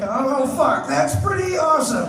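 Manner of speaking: high, loud forced praise, nearly a yell; a woman yelling her nervous catchphrase; shouted catchphrase. Retold or imitated. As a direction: blurt out the catchphrase in a panic.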